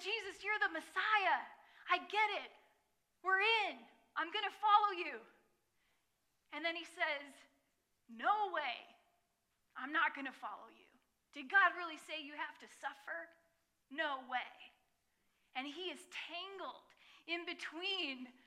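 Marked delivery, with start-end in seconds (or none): 0.0-5.2 loud, higher, excited declaration; Peter's eager vow. Retold or imitated. 8.1-14.7 raised defiant refusal; Peter's refusal. Retold or imitated.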